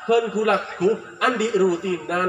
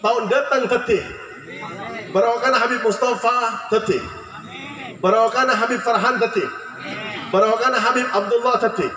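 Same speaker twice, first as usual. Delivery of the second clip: raised call-and-response prayer, each line answered; leading prayer; 3 semitones higher than usual. Acted. Preacher leading the congregation in prayer, raised call-and-response.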